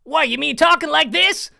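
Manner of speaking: loud, high southern accent. Shown as a demonstration. Host showing the caller his southern accent. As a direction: put on a big southern voice. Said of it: "the southern accent".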